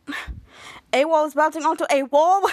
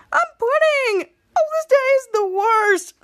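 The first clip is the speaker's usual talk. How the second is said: high-pitched, louder theatrical whine; dramatic wailing; 10 semitones higher, 5 dB louder than usual. Acted. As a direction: wail it like the world is ending. Acted complaint in a story.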